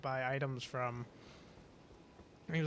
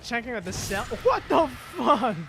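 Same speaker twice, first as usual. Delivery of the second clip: exasperated rant; 6 semitones higher, 5 dB louder than usual. Retold or imitated. Ranting at rude cashier.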